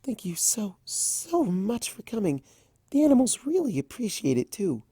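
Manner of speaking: high, whispered gratitude; shy hostess thanking the princess; grateful Fluttershy. Acted. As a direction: thank them softly, almost breathless.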